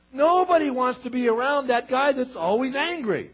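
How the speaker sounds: rising to full-force sermon delivery; forceful preaching. Acted. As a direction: preach it high and loud, driving each line home.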